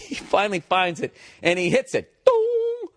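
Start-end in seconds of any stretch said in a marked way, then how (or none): 2.2-2.9 very high sung "dong"; imitated call-button chime. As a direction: ring it out like a cabin chime.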